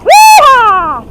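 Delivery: loud high yee-haw; whoop. Real, not acted. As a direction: holler with glee.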